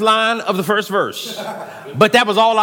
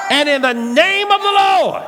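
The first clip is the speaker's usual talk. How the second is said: roaring rallying speech; 8 semitones higher than usual. Acted. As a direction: rebuke the spirits at full force. Shouting prayer of rebuke.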